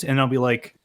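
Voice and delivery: ordinary talk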